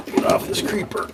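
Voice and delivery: straining cry while getting up; strained groan. Real, not acted. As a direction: push the words out with effort. Struggling off creeper under car.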